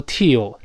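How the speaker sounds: "till" wrongly as "tee-o", long vowel; long-vowel mistake. Shown as a demonstration. Teacher showing what to avoid, "till" as "tee-o". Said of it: "不要读till，这里发短音".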